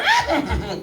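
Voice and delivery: short high whinny; horse noise. Shown as a demonstration. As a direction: neigh back briefly. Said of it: "make horse noises in bed".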